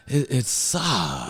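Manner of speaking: very slow, stretched-out retort; drawn-out verdict. Retold or imitated. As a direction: drag out the key word for comic disdain. Blunt retort to Jenna, retold.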